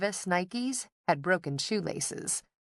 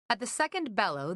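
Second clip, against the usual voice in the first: plain reading voice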